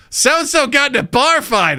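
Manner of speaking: high, eager mock-mom gossip. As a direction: breathlessly pass on hometown gossip.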